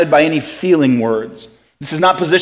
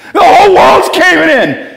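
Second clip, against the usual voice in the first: high, loud mock-panicked cry; freaking out; 7 dB louder than usual. Preacher acting out his panic attack, exaggerated freak-out. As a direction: wail it in hammed-up alarm. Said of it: "I'm freaking out about everything".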